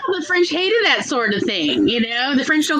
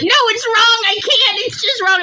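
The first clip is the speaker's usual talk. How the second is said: higher, louder mock complaint; imitating Tom; 10 semitones higher, 4 dB louder than usual. Tom complaining about his French teacher, higher and louder. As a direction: play the frustrated student, pitched up. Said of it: "And he's like".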